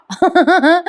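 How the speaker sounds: high laugh over the word. Retold or imitated. Narrator laughing while voicing Ashwin calling his brother. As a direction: burst into a laugh mid-call.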